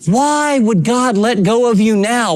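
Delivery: normal talking voice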